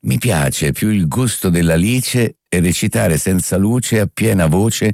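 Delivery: slow, carefully articulated reading; measured elocution drill; diction exercise recitation. Shown as a demonstration. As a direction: recite it slowly, every vowel placed. Reads out a diction exercise sentence.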